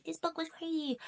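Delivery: high, soft mock voice of a TikTok reviewer; silly put-on voice. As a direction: lightly parody a hyped reviewer. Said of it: "I don't know why I did that voice".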